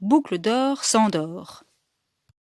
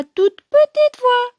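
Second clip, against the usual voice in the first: tiny high voice; 13 semitones higher, 5 dB louder than usual. A narrator's reading. Storyteller introducing Baby Bear's cry, tiny high voice. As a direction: climb into a tiny squeak.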